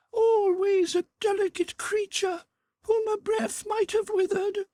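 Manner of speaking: very high, thin otherworldly voice; ghostly spirit voice. A narrator's reading. The Ghost tells Scrooge of his sister, high and otherworldly.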